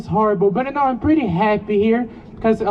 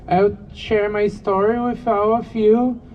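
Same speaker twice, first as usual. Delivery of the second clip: ordinary talk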